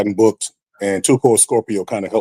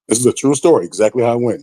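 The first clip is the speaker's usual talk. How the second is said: ordinary talk, nothing marked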